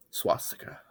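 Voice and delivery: breathy whisper; whispered reveal. A narrator's reading. Narrator reveals what Bob built, whispering. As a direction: drop to a hush for the reveal.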